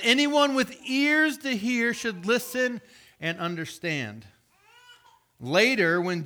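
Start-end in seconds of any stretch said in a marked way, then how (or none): 0.0-4.2 slow, lifted proclamation; solemn scripture reading. Acted. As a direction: call it out slowly. Jesus's solemn call.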